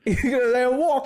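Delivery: high, hammed-up New York squawk; mock New York accent, pitched right up; silly New York accent. Co-host joins in mocking the Penguin, silly New York accent. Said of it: "in a silly New York accent".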